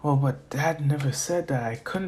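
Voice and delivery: soft, hesitant thinking aloud; inner debate. Acted. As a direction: murmur it to yourself, unsure. Boy debates inwardly.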